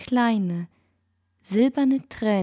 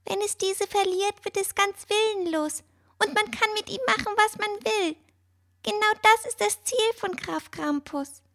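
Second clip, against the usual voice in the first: whispered; 13 semitones higher than usual. A narrator's reading. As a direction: whisper it like a tiny bird. Mister Thompson continues.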